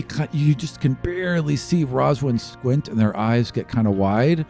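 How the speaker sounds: ordinary talk, nothing marked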